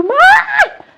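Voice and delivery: high, loud cry of Mom; retold child's cry. Son running to his mother after being left in the car, high loud cry. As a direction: call out to your mother in relief and fear.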